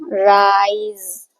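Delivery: Rise stretched very slowly, sound by sound; blended slowly. Shown as a demonstration. Teacher blending letters for kids, very slow. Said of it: "ऐसा पढ़ेंगे ना".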